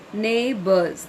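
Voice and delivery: Neighbors split as neigh-bors; two syllables. Shown as a demonstration. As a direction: sound out Neighbors in two separate beats. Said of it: "there's two syllables".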